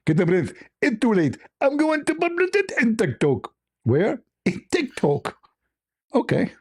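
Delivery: high put-on voice of an angry caller; high scolding impression voice; mock Zakir Naik. S0 acts out Zakir Naik phone call.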